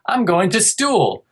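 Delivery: put-on high, slow childlike "stool"; imitating his kid. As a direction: voice the little boy saying "stool" for "school". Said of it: "if he said".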